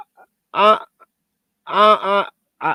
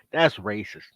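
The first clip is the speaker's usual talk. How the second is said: deadpan mock accusation; 11 semitones lower, 9 dB quieter than usual. Mock accusation after twenty dollar.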